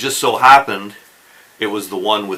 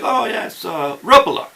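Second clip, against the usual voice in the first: imitating a Finnish man; 9 semitones higher, 4 dB louder than usual. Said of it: "And he's like".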